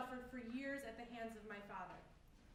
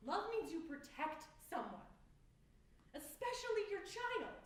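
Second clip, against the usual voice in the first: impassioned reproach; 7 semitones higher than usual. Acted. Confronting mother in monologue.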